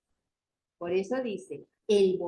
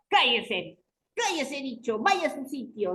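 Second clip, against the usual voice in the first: acted stern teacher's scolding; mock aggression; 4 semitones higher than usual. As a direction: order a pupil about, higher and forceful.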